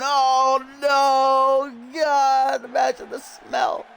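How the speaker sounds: high, strained outcry; horrified. Real, not acted. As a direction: recoil in disgust, voice up high. Dreading the stinky move.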